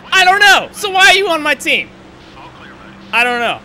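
furious scolding. Real, not acted. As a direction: tear into the crew. Chewing out his pit crew.

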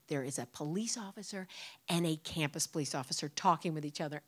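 ordinary delivery

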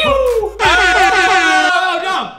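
high shout of dismay; alarmed shout. Real, not acted. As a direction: cry out as it falls. Pizza gummy dropped.

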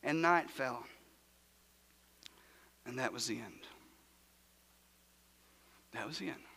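sinking to a low, hushed near-whisper. Real, not acted. Pastor to congregation at the tomb, hushed and low. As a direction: let the words fall soft and low after long silences. Hushed pause after the burial.

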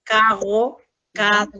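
student repeats the "kaɣo" demo; imitating teacher's carro. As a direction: copy the teacher's weakened "carro". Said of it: "usted la ha hecho".

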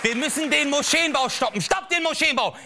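high, urgent mock rabble-rouser; alarmist ranter. A comic mocks the fearmongers as a high alarmist ranter. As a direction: whip up the crowd like a populist.

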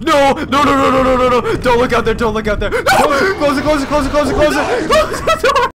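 loud high terrified yelling; panicked screaming. Real, not acted. Monster at door, panic.

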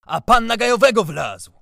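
high, loud accusing yell; furious shout. A narrator's reading. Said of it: "krzyknął".

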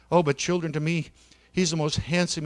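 usual speaking voice

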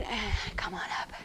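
breathy whispered cue, barely voiced. Real, not acted. Instructor whispering the class up out of the fly. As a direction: let the cue slip out as a whisper.

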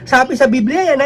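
normal talking voice

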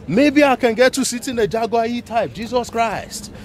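ordinary delivery

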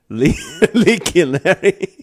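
reads "Leaky Larry" while laughing; breaking into laughter mid-name; laughing through it. Real, not acted. Host laughing at a viewer's boat name. Reading names, cracking up.

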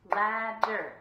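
LAD-DER in two loud beats; clapped out. Shown as a demonstration. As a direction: punch out Ladder syllable by syllable. Clapping out the syllables.

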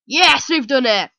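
loud, drawn-out victory shout; triumphant cheer. Real, not acted. Player winning the boss fight, triumphant shout. Celebrating beating a boss in game.

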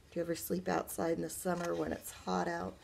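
usual speaking voice

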